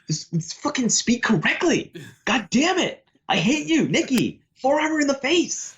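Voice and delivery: very high, squeaky mock tirade; mock angry rant. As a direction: parody your friend's fed-up rage in a cartoon voice. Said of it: "You're just like".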